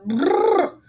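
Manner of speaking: high loud brrr of cold; shivering brrr. Acted.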